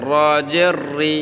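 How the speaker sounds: 'র' and 'রি' trilled with a curled tongue; rolled tongue R. Shown as a demonstration. Teacher repeats for learners, 'রি' with the tongue rolled. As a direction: roll the R in 'রি'. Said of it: "জিহ্বা ঘুরিয়ে বলতে হবে".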